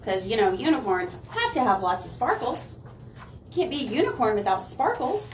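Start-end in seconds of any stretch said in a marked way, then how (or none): none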